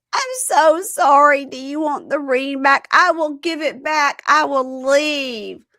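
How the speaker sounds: high, loud, drawn-out whine; mock drunken apology. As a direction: wail it slowly as the drunk fiancée. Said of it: "And she says".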